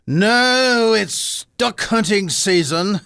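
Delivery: high, loud, drawn-out "No"; cartoon squabble. Rabbit correcting the hunter about the season, loud cartoon protest. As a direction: protest loudly, stretching it out high.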